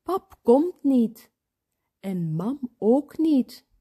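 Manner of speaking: ordinary delivery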